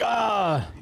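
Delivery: excited yelp. Retold or imitated. Retold excited reaction to show.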